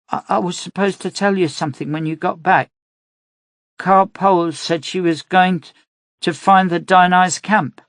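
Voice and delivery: put-on high, hurried girl's voice; a little girl rushing her confession; worried girl. A narrator's reading. Child voice, worried.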